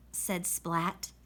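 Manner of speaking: plain reading voice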